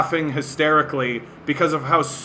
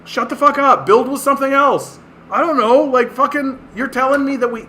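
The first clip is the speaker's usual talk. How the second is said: higher, louder exasperated outburst; 9 semitones higher, 3 dB louder, faster than usual. Real, not acted. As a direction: blurt it out, pitched way up.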